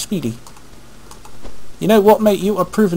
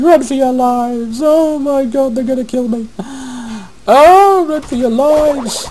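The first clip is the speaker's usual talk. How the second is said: mock panic; 11 semitones higher than usual. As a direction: wail it like a fleeing cartoon villager. Mock panic while fleeing monsters.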